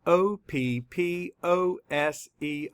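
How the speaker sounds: slow, letter-by-letter spelling; measured classroom spelling; spelling aloud. Shown as a demonstration. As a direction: spell it out slowly, each letter separate. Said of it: "Let's spell it".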